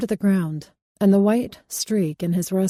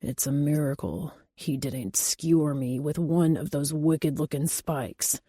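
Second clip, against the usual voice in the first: dark mutter; 4 semitones lower, 6 dB quieter than usual. A narrator's reading. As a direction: grumble it darkly under your breath. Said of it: "Hunter muttered darkly".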